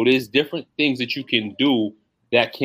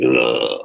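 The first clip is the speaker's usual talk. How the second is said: deep strained lifting grunt; holding breath grunt; 13 semitones lower than usual. Shown as a demonstration. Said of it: "Do it again".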